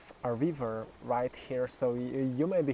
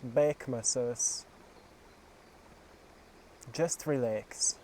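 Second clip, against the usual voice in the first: guided meditation; 3 dB quieter than usual. Acted. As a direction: guide them gently through each breath.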